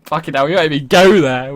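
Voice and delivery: ordinary delivery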